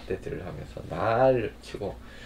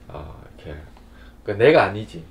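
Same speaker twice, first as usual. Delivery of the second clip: ordinary talk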